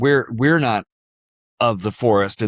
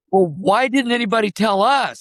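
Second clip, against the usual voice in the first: retold disbelief; 11 semitones higher than usual. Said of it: "I'm going".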